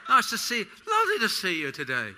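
hammed-up upper-class hello, hello; mock aristocrat, stiff top lip. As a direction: send up the aristocracy, lip never moving. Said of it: "their top lip never moves when they talk".

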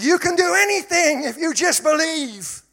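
high, very loud mock-motivational cry; ringing message. Preacher parodying the message to graduates, ringing shout. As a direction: shout the slogan at full pitch. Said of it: "message ringing in their ears".

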